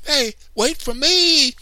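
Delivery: high, loud, slow wail to wait; a slow little brother crying after the others; plaintive cry. A narrator's reading. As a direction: call out left behind and puffing. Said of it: "he cried".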